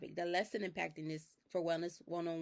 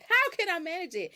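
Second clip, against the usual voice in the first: exasperated; 10 semitones higher, 7 dB louder than usual. Retold or imitated. Exasperated inner question.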